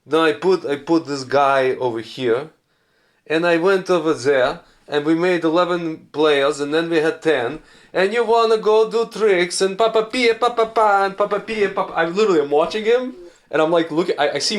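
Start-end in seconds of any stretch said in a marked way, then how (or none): 0.0-11.9 Jose Mourinho. Retold or imitated. Impersonating a soccer coach explaining his tactics, thick Portuguese accent. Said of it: "Jose Mourinho speaking English".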